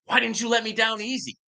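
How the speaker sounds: high, whiny plea; hammed-up upset friend; mock whine. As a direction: plead like a hurt buddy. Crying friend in cat joke.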